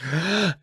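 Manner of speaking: sharp gasp; old man gasp. Acted. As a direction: gasp in fright. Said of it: "Steve gasps".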